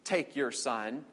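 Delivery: slow, gentle "Please take your son"; slow request. Shown as a demonstration. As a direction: ask it slowly, not a command. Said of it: "fits the context a little better".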